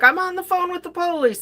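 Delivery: high, fast, impatient brush-off; exasperated daughter. Retold or imitated. Daughter on the phone with police, brushing off her parent, high and impatient. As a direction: fire it back, annoyed and rushed.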